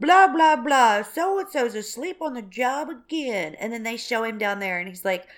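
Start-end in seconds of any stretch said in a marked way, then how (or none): 0.0-3.5 a loud, hammed-up bark that slows down at the end; mock skipper griping about a sleeping deckhand; mock captain. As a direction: play a fed-up captain complaining about his crew. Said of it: "when the captain's like".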